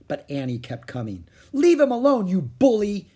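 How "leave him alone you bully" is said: high, loud indignant yell; crying out. A narrator's reading. Annie to the pirate holding Jack, indignant cry. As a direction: shout at a bully to back off. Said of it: "she cried".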